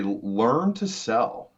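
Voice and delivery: very slow, each word laid down; slow, weighty mentor line; slow emphatic advice. Retold or imitated. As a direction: spell it out to the students. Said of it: "I say".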